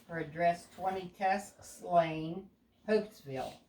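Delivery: ordinary delivery, nothing marked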